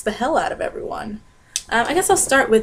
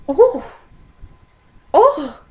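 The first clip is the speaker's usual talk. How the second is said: high, louder mock "Ooh, oh" of the wife; mocking a startled customer's wife, high and louder; 9 semitones higher, 6 dB louder than usual. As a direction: imitate the wife's startled reaction. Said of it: "and the wife's like".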